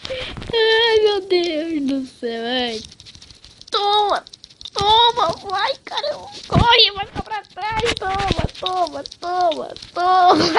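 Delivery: agudo e empolgado, torcendo; torcida empolgada. Real, not acted. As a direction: torcer pela perseguição aos gritos. Torcendo numa perseguição. (torcida empolgada)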